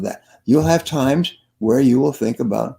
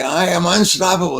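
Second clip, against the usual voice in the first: raised, emphatic crowd chant; with conviction; 5 semitones higher than usual. Retold or imitated. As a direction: declare it like you believe it. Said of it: "say it like you believe it".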